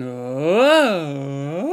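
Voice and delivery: loud falsetto oh swooping up and down. Shown as a demonstration. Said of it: "use some falsetto in there".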